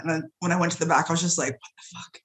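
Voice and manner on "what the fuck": voiceless stunned whisper to oneself; private whisper. Retold or imitated. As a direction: whisper it, barely audible, in disbelief. Muttered privately in the back.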